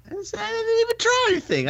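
high, fast, insisting he tried nothing; high, fast protest. Retold or imitated. To his friends about the mother-in-law's blame, a high, fast protest. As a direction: squeak out your innocence to the listeners.

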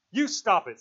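high, loud protest; shrill. A narrator's reading. Piggy tells Ralph to stop talking of murder, shrill. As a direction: cut him off shrilly. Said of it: "said Piggy shrilly".

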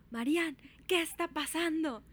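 imitated mother calling out, high and slow; demanding mother. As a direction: demand an answer like an alarmed mom. Imitates angry mother demanding answers.

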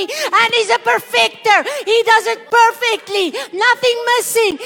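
loud, high, rising preaching build; full-force preaching. Real, not acted. Pastor preaching declarations over the church, loud and high. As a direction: preach it at full power to the congregation. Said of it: "I'm preaching myself out of breath".

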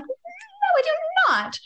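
a high, sarcastic "no, I do not"; mock innocent no. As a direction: play dumb in a high squeak. Snarky reply to pushy mother.

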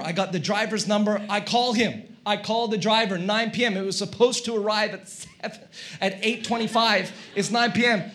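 ordinary talk, nothing marked

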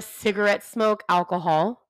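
ordinary delivery

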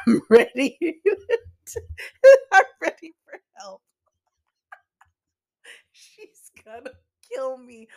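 laughing breaking high and loud. Real, not acted. To listeners about the co-host, laughing through a mock plea. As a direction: crack up mid-sentence.

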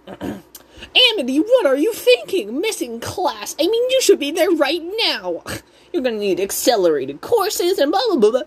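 high-pitched scolding Karen mom; Odalia. Retold or imitated. Odalia scolds Amity for missing class, high Karen voice. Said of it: "Karen mom, Odalia's like".

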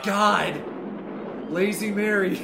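excited shout. Real, not acted.

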